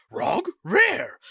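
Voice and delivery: high-pitched puzzled cartoon dog; questioning dog-speak yelp; Scooby-Doo. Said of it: "And he goes".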